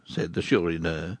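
plain reading voice

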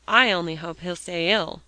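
ordinary delivery

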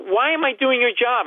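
high-pitched indignant demand; mock yelling. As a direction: protest to the cashier, pitch shooting up. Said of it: "And I'm yelling now".

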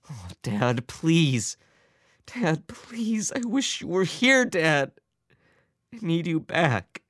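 high, thin, tearful plea; desperate pleading. A narrator's reading. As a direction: beg him to come back. Son begs catatonic father.

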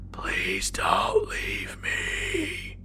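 terrified whisper. A narrator's reading. Boy pleading with the armed thing, faint whisper. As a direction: force it out in a frozen, scared whisper. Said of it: "I tried to mutter out a cry for help".

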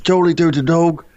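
British dog voice. Pretend. Voicing his dog for friends, lightly British. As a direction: play the dog talking in a mild British accent. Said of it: "he has a British accent, and he talks like this".

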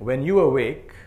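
slow, measured reading of a poem, line by line; poem recitation. Acted. Teacher leads the audience through a memorized poem, slow recitation. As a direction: recite it slowly so the room can echo you.

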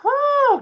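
high loud whew, blown out; demonstrating a calming breath; big exaggerated whew. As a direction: model a big relieving exhale. Parent blowing out breath.

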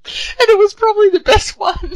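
very high, loud laughing speech. Real, not acted. Host judging the accent attempts, laughing. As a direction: squeak it out through loud laughter.

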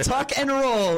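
a playful high-pitched movie stunt call; high mock action cry. Friend jokes about escaping the car, in a high mock action-hero cry. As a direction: squeal Tuck and roll high, like an action hero.

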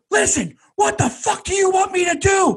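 high-pitched, indignant mock outburst; verbal freakout. Cornered man telling the gang off, retold high-pitched freakout. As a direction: protest frantically, voice pitched well up. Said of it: "I freaked out and I said".

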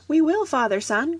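high, eager little cloud voices; eager cry. A narrator's reading. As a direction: call out willingly, pitched well up. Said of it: "cried the little clouds".

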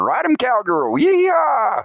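whooping cheer. Retold or imitated. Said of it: "She was on my hips".

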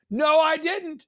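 high mock protest to nobody; imitated yelling. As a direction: imitate a man hollering back at nothing. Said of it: "yelling at".

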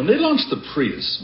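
high, slow mocking repeat; mocking imitation. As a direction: parrot her words back high and slow. Mimics the pitch mockingly.

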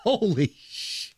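stunned gasp. Real, not acted. Reacting to the artist's name.

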